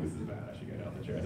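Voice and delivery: whispered fast thought to self; thinking in the bleeding waiting room, whispered; inner whisper. Retold or imitated. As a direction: hush it like a private realization. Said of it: "I was like".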